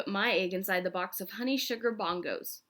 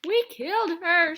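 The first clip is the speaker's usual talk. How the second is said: high, loud panic; high loud we killed her; panicked shout; 10 semitones higher, 8 dB louder than usual. A narrator's reading. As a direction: yell in horror. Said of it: "shouted Neil".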